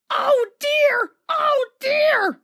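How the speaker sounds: very high, loud wailing truck voices; groaning trucks. A narrator's reading. Broken trucks groaning after the crash, wailing. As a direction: moan in self-pity. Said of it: "they groaned".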